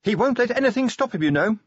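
high-pitched anxious lady; Sybil's voice. A narrator's reading. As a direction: keep Sybil up high and brisk. Sybil insists.

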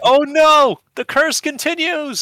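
very high, loud melodramatic wail; mock horror. Dad seeing his kid catch the Pokemon bug, mock horror. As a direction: cry it out slowly as if doomed.

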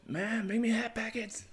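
very high, put-on voice of a spoiled son; mock demanding son. Co-host as freeloading son ordering mom around, high mock demand. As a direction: call up from the basement in a high caricature. Voicing lazy son calling from basement.

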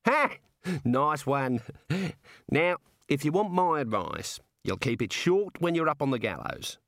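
high bark of "Ha!", then friendly advice; chummy Aussie jailer; amused Australian warder. Acted. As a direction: advise the prisoner genially, in Australian.

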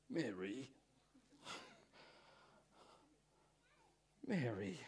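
soft, hushed calling of her name; a soft, tender murmur of the name. Retold or imitated. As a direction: say her name gently, like a quiet call. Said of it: "I reckon he kind of said it like this".

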